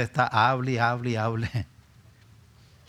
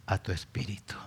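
whispered prayer ending; 2 semitones lower, 6 dB quieter than usual. Acted. Pastor praying for sensitivity, whispered. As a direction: let the words fall to a whisper.

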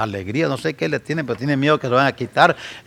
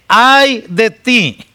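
"Ay de ti" slow, high and loud; solemn slow woe; 9 semitones higher, 7 dB louder, much slower than usual. Shown as a demonstration. Preacher intoning Christ's woe slowly and loudly. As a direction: draw out each word of "Ay de ti". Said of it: "repeated woe".

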